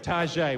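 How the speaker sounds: "Target" said tar-ZHAY; mock-sophisticated "Target", ending in AY; French-style pronunciation. Shown as a demonstration. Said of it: "a little bit of a French sort of style".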